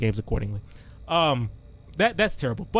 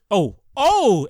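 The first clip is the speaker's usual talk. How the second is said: excited yelp; 7 semitones higher, 8 dB louder than usual. Retold or imitated. Retold reaction to game-winning shot.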